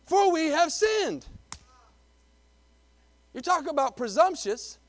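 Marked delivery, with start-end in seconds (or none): 0.1-1.3 high, slow, drawn-out declaration; declaimed confession. Acted. Preacher quotes the people admitting their sin, high declaimed confession. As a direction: proclaim it high and stretched out.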